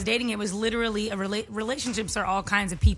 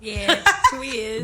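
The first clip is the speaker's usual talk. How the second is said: laughing; 4 dB louder, much slower than usual. Real, not acted. Laughing admission.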